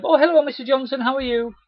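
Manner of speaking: high, loud cheery hello; cheery wave. Shown as a demonstration. Rep waving to a customer in the garden, cheery. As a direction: greet her like an old friend. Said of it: "always just cheery wave".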